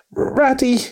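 high excited call across the road; joyful call. A narrator's reading. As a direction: call out to a friend with sudden joy. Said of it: "he called out, full of joyful excitement".

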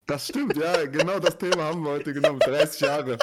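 loud, mock-serious agreement; loud teasing agreement. Real, not acted. Teasing his co-host about turning thirty, loud and mock-serious. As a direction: play along loudly with the joke.

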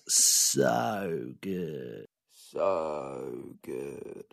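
low, soft and drawn-out; slow, hushed admiration, fading away; savouring praise. Real, not acted. Savouring praise of the football analogy.

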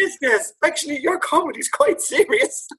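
put-on very high, laughing old-man voice; laughing dad impression. Quoting a friend's late dad, high laughing impression. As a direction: squeak out his line through laughter. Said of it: "And he said".

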